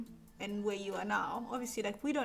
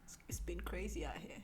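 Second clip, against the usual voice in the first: hushed whisper; 4 semitones lower, 12 dB quieter than usual. Real, not acted. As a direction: drop to a whisper. Confiding aside about turning 21.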